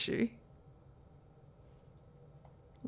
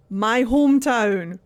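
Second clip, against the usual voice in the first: excited shout; 6 dB louder than usual. Real, not acted. Glasgow streamer shouting proudly on hearing her hometown accent. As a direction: shout it out in delight.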